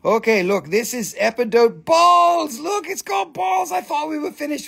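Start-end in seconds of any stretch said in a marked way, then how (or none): 1.8-4.7 high excited exclaim; excited shout. Real, not acted. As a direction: shout it out with delight. Excited about balls.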